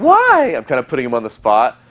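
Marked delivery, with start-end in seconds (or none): 0.0-0.5 put-on high, loud girly why; girl voice. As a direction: squeal it like a surprised girl. Girl's reply in story.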